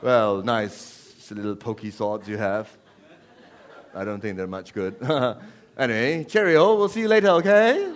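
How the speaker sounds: hammed-up British chit-chat with chuckles; mock polite British. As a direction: make polite British small talk before battle. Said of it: "some polite British war?".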